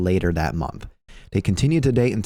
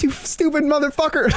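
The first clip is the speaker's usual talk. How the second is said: pitched way up, exasperated disbelief; exasperated high outburst. Retold or imitated. Telling his paintball teammate he's been sitting on that, high exasperated squeal.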